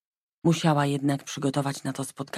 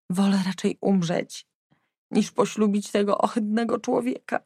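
despairing; 5 semitones higher than usual. Acted. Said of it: "dodała zrozpaczona".

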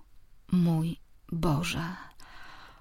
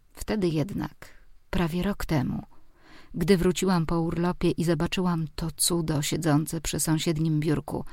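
usual speaking voice

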